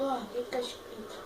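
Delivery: normal talking voice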